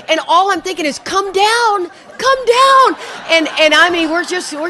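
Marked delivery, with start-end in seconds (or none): 1.0-3.0 pleading. Retold or imitated. Pleading bus lands.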